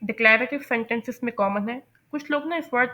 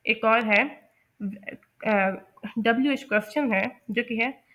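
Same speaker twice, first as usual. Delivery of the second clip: ordinary talk, nothing marked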